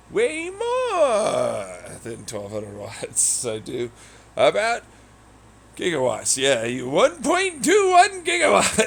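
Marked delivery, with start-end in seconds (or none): 4.4-8.8 excited. Pretend. Over-the-top gigawatts joke.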